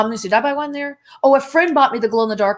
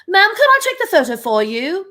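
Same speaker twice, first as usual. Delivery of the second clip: higher-pitched mock British accent with a rising question; imitated British accent; 8 semitones higher, 3 dB louder than usual. Retelling to the British host how a millennial offered a photo, imitated British accent. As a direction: put on a polite British man's accent, pitched up. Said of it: "in your accent".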